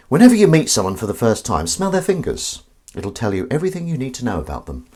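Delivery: ordinary talk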